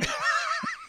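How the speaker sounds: a sudden high squeal of a laugh. Real, not acted. Recalling the absurd accusation, a high squeal of laughter. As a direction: burst out in a high-pitched laugh.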